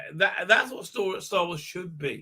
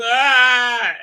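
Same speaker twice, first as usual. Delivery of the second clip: mock scream; 6 semitones higher, 10 dB louder than usual. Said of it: "And he's".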